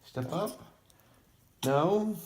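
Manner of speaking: sing-song high coax. Real, not acted. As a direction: coax a pet up, high and sweet. Coaxing bird onto hand.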